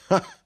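single high, loud "ah!" laugh; knife grinder's short high laugh; acted burst of laughter. A narrator's reading. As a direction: let out one sharp laugh. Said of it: "e di nuovo rise".

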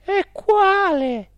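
high, loud, drawn-out question; anxious directress. A narrator's reading. Directress asking the spirit which sin, high questioning. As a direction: ask urgently in a high woman's voice.

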